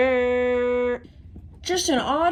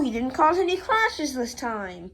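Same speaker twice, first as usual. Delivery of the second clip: ordinary talk, nothing marked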